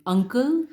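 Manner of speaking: quick short U on "uncle", voice up. Shown as a demonstration.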